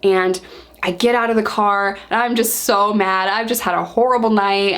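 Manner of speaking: ordinary talk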